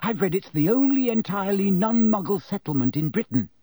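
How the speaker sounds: high, eager voice; keen. A narrator's reading. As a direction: pipe up keenly with a fact. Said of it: "asked Hermione keenly".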